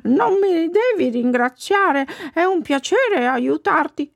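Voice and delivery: high, slow talking-dog voice; kindly dog. Acted. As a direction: reassure as a gentle dog, drawing words out. Il cane gentile risponde. (voce acuta e lenta da cane gentile)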